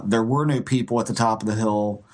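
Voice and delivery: usual speaking voice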